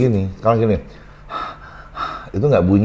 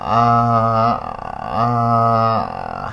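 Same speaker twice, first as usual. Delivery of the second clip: held vowel demo; much slower than usual. Shown as a demonstration.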